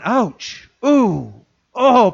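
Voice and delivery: pained little ouch; mock wince. Listener stung by the sermon, mock pained. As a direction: groan as if cut by the word. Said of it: "And you're going".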